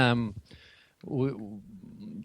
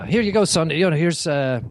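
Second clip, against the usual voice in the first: raised, louder friendly offer; woman's voice; 5 semitones higher, 5 dB louder than usual. Retold or imitated. Woman offers toys to a child, lightly voiced. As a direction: lightly voice a woman calling over. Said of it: "and she goes".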